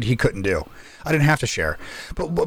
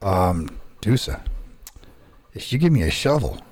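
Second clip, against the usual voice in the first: mock deadpan threat; 6 semitones lower, 4 dB quieter than usual. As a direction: pretend to calmly bargain, pausing between phrases. Retelling his deadpan threat to a friend.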